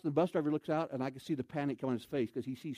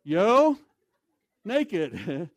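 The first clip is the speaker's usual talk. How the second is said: a silly demo of saying good for you; loud Yo, naked; 7 dB louder than usual. Shown as a demonstration. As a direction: shout the Yo, then lift naked. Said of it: "you say".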